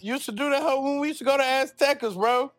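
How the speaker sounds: high, loud ribbing; excited teasing. Real, not acted. Ribbing Jeremy about old karaoke nights, high and loud. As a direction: call him out, high and loud.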